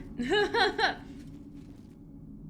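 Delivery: high, breathy giggle trailing off; laughing. Real, not acted. Streamer amused by an odd insult, giggling laugh. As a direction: giggle helplessly. Amused by the term Cracker Jack.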